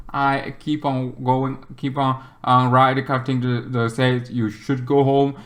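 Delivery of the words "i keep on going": normal talking voice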